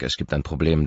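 usual speaking voice

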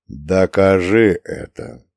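slow, low spirit demand; disembodied voice. A narrator's reading. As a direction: draw it out like an ancient spirit. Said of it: "бесплотный голос".

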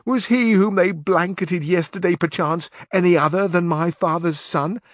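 high, loud aggrieved protest; indignant squire. A narrator's reading. Squire protesting to his knight about his own losses, high loud indignation. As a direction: complain that the losses are yours. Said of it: "replied Sancho".

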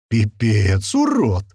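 astonished disbelief; stunned. A narrator's reading. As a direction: say it dumbfounded. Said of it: "обалдело произнёс Томас".

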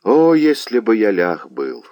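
anguished, drawn-out exclamation; a lover's lament, stretching the opening "О"; pained cry. A narrator's reading. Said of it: "пронзённый болью".

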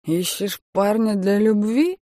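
put-on slow, slurred drunken leer; drunk heckler. A narrator's reading.

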